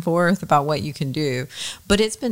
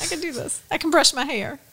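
mock pep talk; 10 semitones higher than usual. Friend mocks getting ready, high mock self-encouragement. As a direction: cheer yourself on jokingly.

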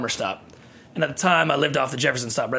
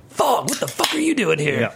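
higher-pitched mock incredulous greeting; imitated surprised challenge; 4 semitones higher than usual. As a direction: act out a cook's startled challenge. Cook runs in, surprised to see him.

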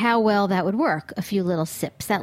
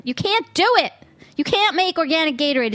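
high-pitched exasperated outburst; exasperated rant; 7 semitones higher, 4 dB louder than usual. Real, not acted. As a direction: rant in disbelief. Ranting in disbelief.

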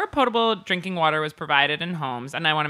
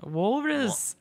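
put-on [name] accent; 6 semitones higher, 3 dB quieter than usual. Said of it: "like, [name]".

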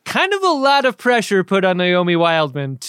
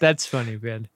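lower, dry "That's funny"; deadpan; 6 semitones lower, 4 dB quieter than usual. Acted. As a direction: say "That's funny" without a trace of laughter. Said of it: "don't laugh and say".

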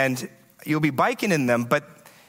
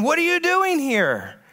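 much higher, outraged pedestrian; mock scream; 10 semitones higher than usual. Pedestrian screaming at the cyclist, outraged mock yell. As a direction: imitate the pedestrian's indignant yelling. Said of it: "they started screaming at me".

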